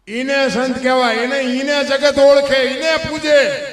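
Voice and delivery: loud, high, slowing proclamation; preaching at full force. Acted. Preacher to the crowd on saints, loud proclaiming. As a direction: declaim it to the whole crowd.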